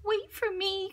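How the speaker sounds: high mouse calling after friends; little mouse crying. A narrator's reading. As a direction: squeak it out like a small mouse left behind. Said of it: "cried Mouse".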